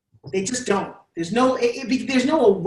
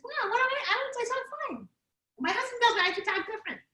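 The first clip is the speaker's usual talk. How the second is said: very high, soft squeaky voice; mock high squeak, protesting lightly; Lisa; 13 semitones higher, 8 dB quieter than usual. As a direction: imitate a student with a tiny cutesy voice. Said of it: "And Lisa talks like this".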